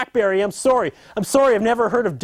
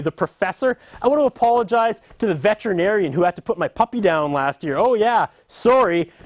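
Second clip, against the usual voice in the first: ordinary delivery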